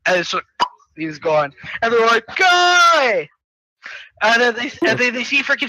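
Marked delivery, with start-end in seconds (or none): 2.4-3.3 high, loud, stretched shout; mock anguished cry. Friends seeing the guy gone, mock anguished shout.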